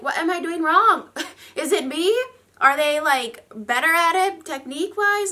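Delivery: hammed-up puzzled complaint; mock bafflement. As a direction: act out comic self-doubt. Retelling her baffled question to herself.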